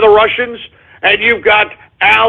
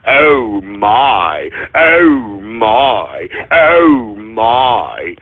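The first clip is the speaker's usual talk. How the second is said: lower, mock-prissy "oh my"; mocking listeners; 9 semitones lower, much slower than usual. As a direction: make fun of the giggling audience. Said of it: "I can hear half of you idiots ... saying".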